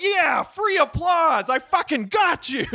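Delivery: mock-gloating comic; a touch of smug triumph. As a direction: mildly crow over easy applause. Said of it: "we're like".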